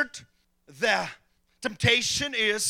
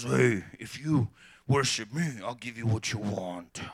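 mean devil voice; 11 semitones lower than usual. Retold or imitated. Pastor mocks the horned devil's mean voice. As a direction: growl it low like a cartoon devil. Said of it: "That's not how the devil speaks".

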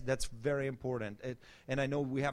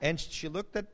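usual speaking voice

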